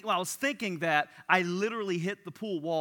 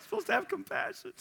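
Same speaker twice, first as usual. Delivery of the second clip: mock preachy; 13 semitones higher than usual. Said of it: "I'm just kidding".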